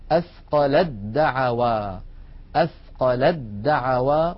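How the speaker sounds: أثقل الدعوى said atqaladda'wā, ت merged into a doubled د; slow demo, no ت before the د; merged reading. Shown as a demonstration. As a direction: repeat it slowly, jumping straight to the doubled د. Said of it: "لا أنطق التاء بل أنتقل مباشرة إلى دال مشددة".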